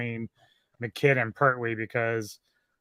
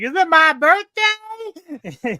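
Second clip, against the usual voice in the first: put-on much higher, louder, slow drunken voice; drunk Wells; 9 dB louder, much slower than usual. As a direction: drawl it out like a happy drunk. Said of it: "Wells is getting, like, drunk as shit".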